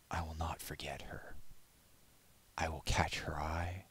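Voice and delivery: whispered, determined vow; soft whisper. Acted. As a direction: hush it like a secret oath. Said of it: "his voice a soft whisper".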